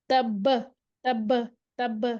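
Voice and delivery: bounced, echoing ب at the end of تب; تب said with a bouncing qalqala on the final ب. Shown as a demonstration. Teacher shows students the qalqala bounce on تب. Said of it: "ایسے کر کے پڑھیں گے".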